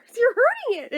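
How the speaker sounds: high, loud playful panic over the bunny; hammed-up horrified mom; mom's mock alarm. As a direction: squeal it like a mom teasing her kids. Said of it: "and be like".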